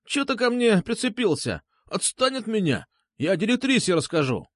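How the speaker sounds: high, tearful protest; whiny wailing. A narrator's reading. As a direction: whine and threaten to tell. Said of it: "плаксиво заголосил он". (плаксиво голосить)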